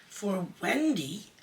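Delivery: a slow, stunned question; appalled disbelief; aghast. A narrator's reading. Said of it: "John said aghast".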